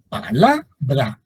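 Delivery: pa-LA-bra, syllable by syllable; slow "palabra", leaning on LA; stress on middle syllable. Shown as a demonstration. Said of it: "la sílaba tónica es la".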